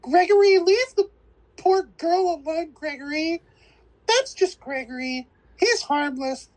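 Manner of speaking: high, hammed-up scolding mother voice; mock fussing woman. Retelling the movie, a hammed-up fussing woman defending Gregory. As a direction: chide him like a fussy older woman.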